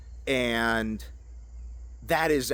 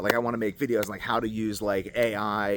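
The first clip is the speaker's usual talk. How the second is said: ordinary talk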